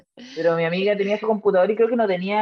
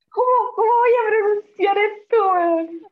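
laughing; 13 semitones higher, 4 dB louder, slower than usual. Real, not acted. Can't pronounce message.

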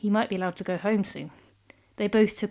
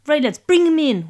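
gruff henchman; 6 semitones higher, 8 dB louder than usual. Acted. Henchman orders Kevin seized.

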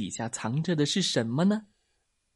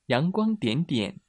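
usual speaking voice

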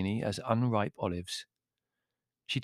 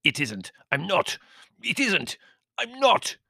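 climbing, frantic denials; incoherent rage; 10 semitones higher, 3 dB louder than usual. A narrator's reading. Servant denying he is Turk, mounting rage. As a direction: get higher and more enraged with each denial. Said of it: "almost incoherent with rage".

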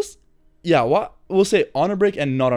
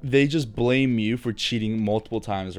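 normal talking voice

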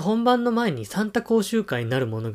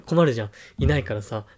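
ordinary delivery

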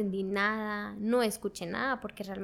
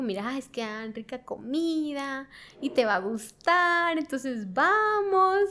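coaxing mother; 2 semitones higher, 3 dB louder, slower than usual. Retold or imitated. Mother tempting daughter with free food, coaxing sing-out. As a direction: stretch it out like a mother tempting her child.

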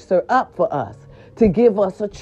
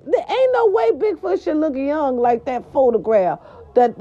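ordinary delivery

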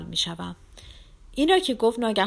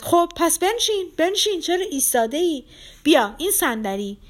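high, loud impatient orders; shouted command; 10 semitones higher, 6 dB louder than usual. A narrator's reading. Lizaveta orders him to take a chair, shouting. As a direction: shout it, bustling and insistent. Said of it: "لیزاوتا پراکفیونا داد زد".

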